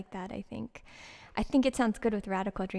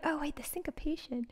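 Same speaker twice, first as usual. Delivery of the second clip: whisper; 3 semitones higher, 8 dB quieter than usual. Retold or imitated. Retold realization aside.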